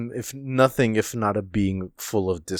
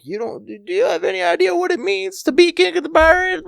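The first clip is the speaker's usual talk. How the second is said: very high, fast screechy rant; hammed-up shrieking tirade that builds louder; yelling Big Mom; 4 dB louder, faster than usual. Big Mom just keeps on yelling, high hammed-up screech. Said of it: "Big Mom just keeps on yelling".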